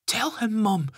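put-on desperate boy, very high and loud. A narrator's reading. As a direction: appeal to mum in a high child's voice. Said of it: "says Ben in desperation".

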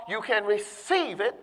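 ordinary talk

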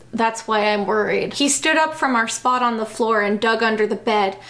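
usual speaking voice

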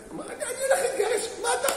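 high, laughing disbelief; imitating a man laughing it off; scoffing laugh. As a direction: laugh off the idea as absurd. Man voiced scoffing at lecture.

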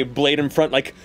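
ordinary talk, nothing marked

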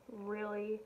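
ordinary talk

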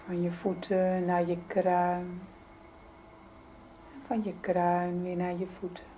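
usual speaking voice

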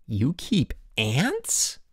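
very slow, incredulous question rising at the end. A narrator's reading. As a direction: stretch it out in disbelief. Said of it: "echoed a dozen voices".

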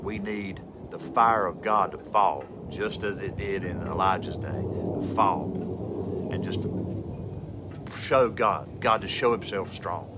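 low, hushed, measured plea for revival; solemn preaching appeal. Real, not acted. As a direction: drop low and soft, let the phrases breathe.

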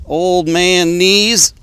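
groaning with effort while getting down; straining. Real, not acted. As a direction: force it out as you kneel down. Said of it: "getting too old for this stuff".

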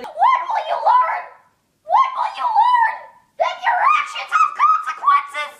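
mock scolding shout. Scolding Peter for sleeping with somebody, a hammed-up laughing shriek. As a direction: shriek it like a cartoon parent scolding.

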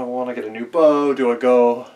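ordinary talk

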